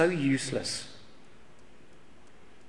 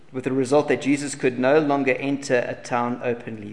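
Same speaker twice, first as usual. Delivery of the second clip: usual speaking voice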